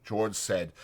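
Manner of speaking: ordinary talk, nothing marked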